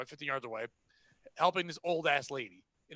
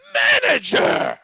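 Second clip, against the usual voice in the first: shrieking high and very loud; screaming; 12 dB louder, much slower than usual. Retold or imitated. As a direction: scream the title across the store. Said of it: "this woman that was on the phone is screaming".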